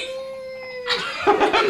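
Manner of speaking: high shrieking giggle. Real, not acted. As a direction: squeal with laughter. Baby bounced, delighted.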